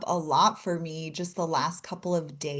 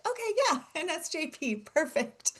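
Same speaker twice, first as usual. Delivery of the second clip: amused quote; 9 semitones higher, 3 dB quieter than usual. Retold or imitated. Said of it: "I was like".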